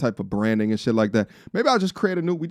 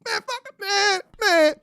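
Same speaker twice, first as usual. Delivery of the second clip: exasperated outburst. Real, not acted.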